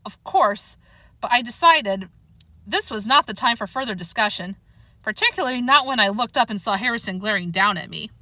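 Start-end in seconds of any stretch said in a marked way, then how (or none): none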